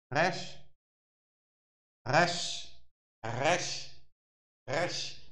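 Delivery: "resh" repeated slowly, the r rolled softly at the front of the mouth; Hebrew letter resh. Shown as a demonstration. Teacher drilling his class on the Hebrew letter, patient pronunciation demo. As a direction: drill "resh" with a light trilled r, each on a rising tune. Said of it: "ele se pronuncia resh".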